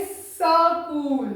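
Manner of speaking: स्कूल read as a stretched "saa-kool"; full sa. Shown as a demonstration. Teacher showing the misspelt reading, स्कूल as "sa-kool". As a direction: give the स of स्कूल a full vowel, drawn out. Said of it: "पूरे व्यंजन की तरह पढ़ेंगे".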